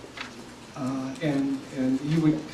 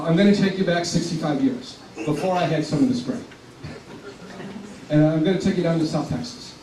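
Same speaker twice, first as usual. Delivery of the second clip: usual speaking voice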